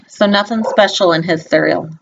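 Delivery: plain reading voice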